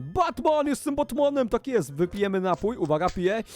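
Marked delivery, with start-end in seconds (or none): 0.0-1.9 high, excited mock-superhero call; hammed-up superhero cry; Batman. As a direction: announce yourself as a playful Batman, pitched up. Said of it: "będę teraz skakał jako Batman".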